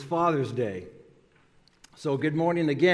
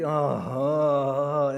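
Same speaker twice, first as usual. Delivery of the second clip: groaning; much slower than usual. Retold or imitated. Third dad in the waiting room groans at his news, mock groaning. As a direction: moan it out like a man in dismay. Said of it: "he's groaning".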